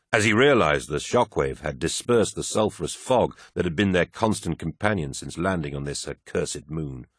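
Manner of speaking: plain reading voice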